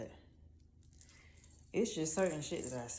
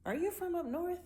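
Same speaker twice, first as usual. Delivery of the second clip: lightly higher quoted question; quoting herself asking; 10 semitones higher than usual. Retold or imitated. Speaker quoting herself to a stranger, lightly higher question. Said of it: "and I be like".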